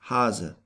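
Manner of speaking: Hase with a long A as in father; long German A. Shown as a demonstration. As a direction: say Hase with an open, drawn A like father. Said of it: "pronounced like in the English word father".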